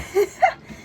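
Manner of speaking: short high burst of laughter; quick high-pitched laugh. Real, not acted. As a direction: laugh at your own question. Laughs at her own question.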